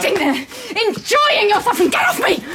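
high-pitched furious rant. Acted. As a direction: keep ranting while fighting off restraint. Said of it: "lower your voice".

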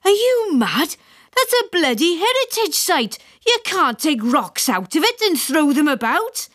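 high-pitched indignant scolding; incredulous, scandalised outburst; screamed in outrage. A narrator's reading. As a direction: berate him in a high yell. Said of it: "screamed my father".

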